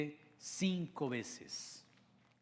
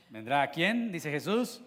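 normal talking voice

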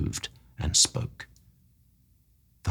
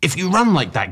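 high, loud warning; cried out; 10 semitones higher, 3 dB louder than usual. A narrator's reading. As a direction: call out a warning to a running man. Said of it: "I cried".

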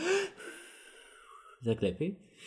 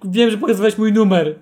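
louder and higher mock reproach on "Wiem"; playful accusing "Wiem, że pokazywałeś mój numer"; 6 semitones higher, 7 dB louder than usual. Shown as a demonstration. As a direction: accuse him, half joking. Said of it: "ten wyrzut".

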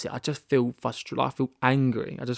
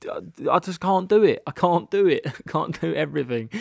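high, loud protest with a laugh in it; overwhelmed, half-laughing exasperation; exasperated refusal; 5 semitones higher, 3 dB louder than usual. Retold or imitated. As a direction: protest that it is all too much. Acting out overwhelmed reply to advice.

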